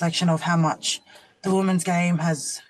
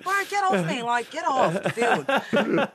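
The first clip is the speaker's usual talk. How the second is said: laughing brush-off; 6 semitones higher, slower than usual. Retold or imitated.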